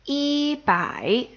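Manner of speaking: "yi" high and level, "bai" dipping; first tone, third tone. Shown as a demonstration. Teacher gives original tones for practice, "yi" level. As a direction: hold "yi" level, dip "bai". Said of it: "first tone and third tone".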